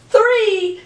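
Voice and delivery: drawn-out, high exclamation of the number; enthusiastic. Shown as a demonstration. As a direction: sing out the exclamation point. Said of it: "You have to say it with enthusiasm".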